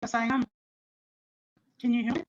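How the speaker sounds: ordinary delivery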